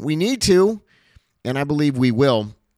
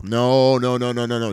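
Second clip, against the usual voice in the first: usual speaking voice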